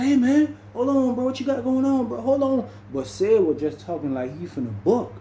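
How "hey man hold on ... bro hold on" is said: high, urgent pleading voice; mock panicked high voice, retelling; nervous stalling. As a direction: jump way up in pitch, scared and stalling. Sid stalling a threat.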